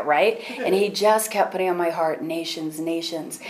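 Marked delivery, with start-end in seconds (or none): 2.2-3.4 hushed, soft repetition of each word; soft inner voice. Retold or imitated. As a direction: let it echo softly like a voice in the heart. God kept putting on her heart.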